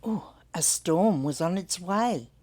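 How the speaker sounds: usual speaking voice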